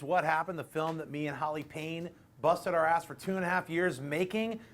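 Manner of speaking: ordinary talk, nothing marked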